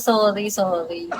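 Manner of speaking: sorry said through a laugh; embarrassed, giggly sorry; laughing apology. Real, not acted. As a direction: apologise while giggling at yourself.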